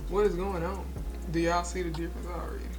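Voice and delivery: normal talking voice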